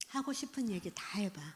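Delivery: 하고 싶은 얘기 다 해봐 breathed as a soft whisper. Shown as a demonstration. Lecturer demonstrating a gentle invitation to a teen, whispered. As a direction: hush it gently, all breath.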